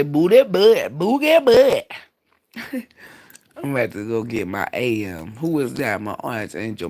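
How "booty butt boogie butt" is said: high, loud squeal on boogie butt; silly squeal. Acted. Joking to his viewers, a high squealed boogie butt. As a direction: squeak it out like a joke.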